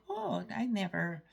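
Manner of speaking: usual speaking voice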